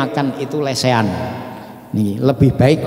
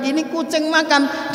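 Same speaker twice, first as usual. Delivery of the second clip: high, soft mocking quote; a hint of a scoffing passerby, higher; quoting the husband; 14 semitones higher, 5 dB quieter than usual. Husband scoffing at the diners, high and soft. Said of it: "dia bilang".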